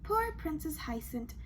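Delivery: usual speaking voice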